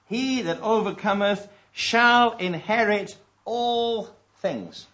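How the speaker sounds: preacher lifting the verse high; proclaiming scripture. Acted. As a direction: ring the promise out.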